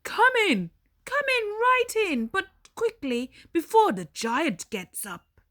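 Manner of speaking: high, hurried and urgent giantess; a big friendly giantess hurrying a guest in. Acted. Giantess urging Jack inside before the giant wakes, high and hurried.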